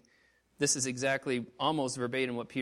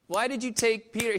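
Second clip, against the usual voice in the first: raised, indignant protest to God; retold anger at God; 6 semitones higher, 6 dB louder than usual. Preacher recalling anger over his friend's loss, indignant protest. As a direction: confront God, louder, reliving the anger. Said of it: "I was angry at God".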